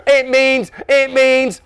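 put-on high, loud childish voice; stalling little brother. A narrator's reading. Dave challenged by his brother, stalling. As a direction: hunt loudly for the answer.

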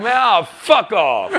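loud, high, drawn-out brush-off; imitated irate doorman, loud and slow; doorman's angry dismissal. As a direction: yell it like a bouncer slamming the door. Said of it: "slams the door in our face".

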